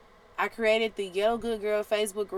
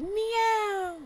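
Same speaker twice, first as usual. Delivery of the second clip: high, small mock meow; a preacher mocking the devil as a house cat; tiny cat meow; 11 semitones higher than usual. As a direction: make the devil a harmless kitten. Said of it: "He's like".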